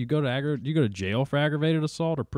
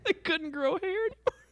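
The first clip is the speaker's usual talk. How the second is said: squeaky laughing description, pitched up. Real, not acted. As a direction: describe it while giggling helplessly. Laughing while describing the head.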